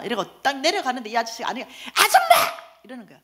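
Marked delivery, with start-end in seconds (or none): 1.6-2.5 very high, loud mock shriek; hammed-up angry call; yelling. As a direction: holler at her across the passage. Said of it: "나보고 고함을 지르는 거예요".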